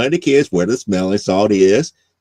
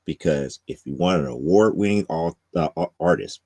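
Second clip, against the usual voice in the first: slow, lower announcer read; sponsor read; 6 semitones lower, 6 dB quieter, slower than usual. Acted. Host closing the show with the sponsor plug, slow announcer read. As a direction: roll out the sponsor credit like an ad.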